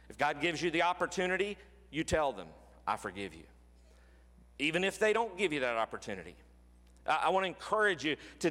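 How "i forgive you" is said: tender. Acted. Voicing words to tell offender.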